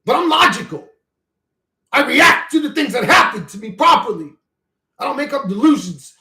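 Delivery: high, loud and insistent; heated rant. Real, not acted. Arguing with chat about God, loud and high. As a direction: drive each point home hard. Ranting on stream.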